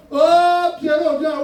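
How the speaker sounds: high, drawn-out "Oh" hailing a friend; calling a pal. Acted. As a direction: holler an invitation to your mate. Friend calling him out for weekend.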